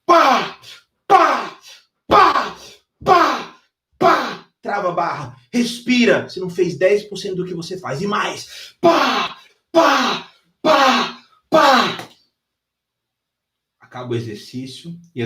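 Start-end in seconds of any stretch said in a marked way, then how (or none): 0.0-4.5 loud high barking reps; forceful rep shouts. Retold or imitated. Trainer counts reps out loud as student lifts. 8.8-12.1 loud high barking reps; forceful rep shouts. Retold or imitated. Trainer counts reps out loud as student lifts.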